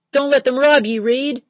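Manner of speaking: loud, high shout across the shop; captain shouting a warning to his mate. A narrator's reading. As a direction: call out to Reed with force. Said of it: "Paddy shouted".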